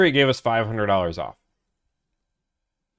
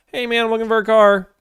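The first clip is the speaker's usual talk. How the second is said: casual customer voice; 11 semitones higher, 5 dB louder than usual. Retold or imitated. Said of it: "It's a pretty, like".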